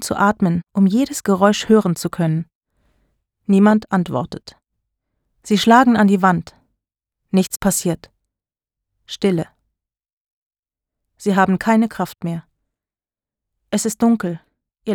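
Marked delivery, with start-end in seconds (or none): none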